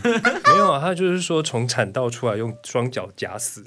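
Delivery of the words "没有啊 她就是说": laughing. Real, not acted. Laughing at mother's threat.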